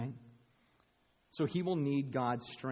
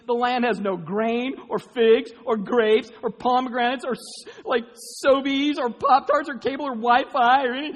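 mock whiny complaint, loud and high; whining Israelites; 10 semitones higher, 12 dB louder than usual. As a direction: ham up the grumbling crowd.

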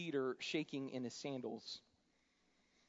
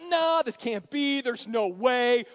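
disbelief; 9 semitones higher, 14 dB louder, slower than usual. Acted. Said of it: "The others are like".

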